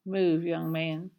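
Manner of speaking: low, slow, quiet order; menacing mother. A narrator's reading. Mama ordering her son to move, quiet menace. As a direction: threaten with quiet control.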